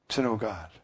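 usual speaking voice

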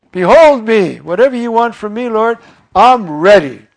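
preacher voicing the faithful answer to a call; fervent offering. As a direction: offer your whole self to God. Acting out answering God's call.

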